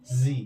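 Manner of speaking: जी as "zee" with a buzzing z; American Z name. Shown as a demonstration. Teacher giving the other reading, American Z name. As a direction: say जी with z, not j. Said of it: "American English में जेड को जी".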